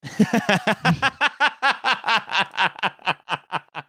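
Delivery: long high giggle; high laugh. Real, not acted. Laughs at accent jab.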